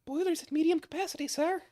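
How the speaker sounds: put-on high-pitched French-accented dragoness. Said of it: "He speaks in a French accent".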